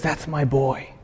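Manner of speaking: put-on God speaking softly to His child; tender father. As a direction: speak it like a proud father leaning close. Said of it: "God looks at you and says".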